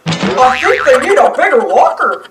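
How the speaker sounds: lightly voiced dopey cartoon dog; Goofy. Goofy peeking into the locker, lightly goofy. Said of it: "says Goofy".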